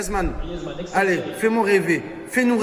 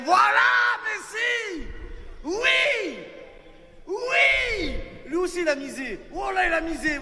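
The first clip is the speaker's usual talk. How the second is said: high, ecstatic yelling; excited cheering; 8 semitones higher, 3 dB quieter than usual. Real, not acted. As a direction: cheer like a fan in the stands. Cheering Messi's goal.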